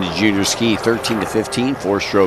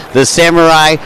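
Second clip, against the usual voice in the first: the Samurai announced louder and higher; announcer hype; 6 semitones higher, 6 dB louder than usual. Real, not acted. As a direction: build up the nickname. Introducing guest nickname.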